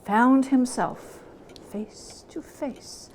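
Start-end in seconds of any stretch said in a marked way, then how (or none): 1.7-3.1 slow hushed whisper; slow eerie whisper. A narrator's reading. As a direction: draw it out under your breath. Meeting the ghost.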